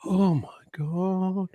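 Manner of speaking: slow, hushed mock awe; lightly voiced smitten gasp, slow and soft. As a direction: imitate a stunned listener, drawn out. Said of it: "you just sit there going".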